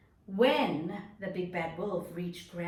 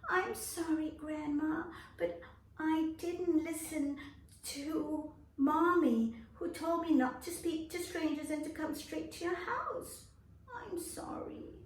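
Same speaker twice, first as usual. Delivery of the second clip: put-on higher, apologetic child voice; little girl apologising; sorry little girl; 9 semitones higher than usual.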